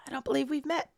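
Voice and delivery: high, raspy voice of a shy student. Acted. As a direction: rasp the line out softly. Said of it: "me trying to do raspy voice".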